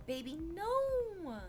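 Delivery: high, slow and drawn out; a pitying baby, no; gently scolding. Acted. Host answering a viewer who loves trashy tattoos, high and scolding.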